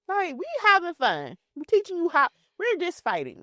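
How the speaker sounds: high, pleading justification; high-pitched exasperated explaining; mock protest. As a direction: imitate kids insisting it's only play. Cousins protesting it was just fun.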